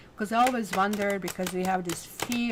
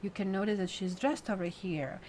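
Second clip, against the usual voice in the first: usual speaking voice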